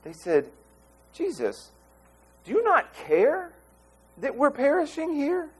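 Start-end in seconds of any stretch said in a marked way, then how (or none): 1.1-5.5 a desperate, high plea; anguished pleading. Retold or imitated. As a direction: pitch jumps high on "do you not care", slowed. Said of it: "It's a question of value".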